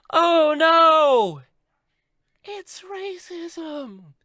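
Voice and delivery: very high wail, drawn out; mock horror. Mock alarm in the story.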